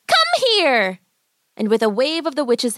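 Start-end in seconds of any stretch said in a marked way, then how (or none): 0.1-1.0 high, sharp witch voice; sharp witch command. A narrator's reading. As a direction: order her over like a witch. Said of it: "she called sharply".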